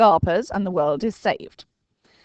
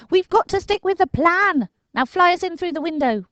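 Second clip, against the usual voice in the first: put-on high, louder boy, resolute; firm Dinking; 13 semitones higher, 5 dB louder than usual. A narrator's reading. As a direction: hold the line on the plan. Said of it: "said Dinking".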